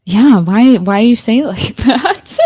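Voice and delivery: loud, high question breaking into laughter; laughing tease. Real, not acted. Host teases guest about the accent, laughing. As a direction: crack up while asking.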